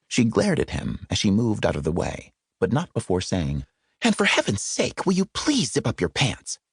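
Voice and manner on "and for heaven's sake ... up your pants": higher, tart parting remark; a glaring lady's last word; annoyed woman snapping at him; exasperated woman. Acted. She glared, parting shot.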